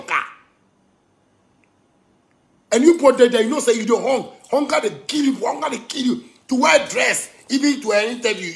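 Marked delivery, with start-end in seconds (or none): 2.7-8.5 heated rant. Real, not acted. Speaker scolds people about poverty, heated rant. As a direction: push the point hard and high.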